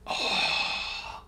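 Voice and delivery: long exhaled sigh, imitating death; dying final breath. Host imitates the dying character's last breath, long sigh. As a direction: let out one last breath, acted. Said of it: "does that whole, like, final breath".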